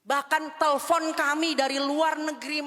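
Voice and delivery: normal talking voice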